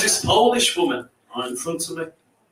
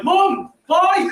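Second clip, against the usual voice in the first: high wailing farewell; hammed-up crying goodbye; mock tearful cry; 13 semitones higher, 3 dB louder than usual. Son crying goodbye as mum leaves, high mock wail. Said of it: "I cried".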